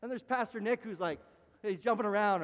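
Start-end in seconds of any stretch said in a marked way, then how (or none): none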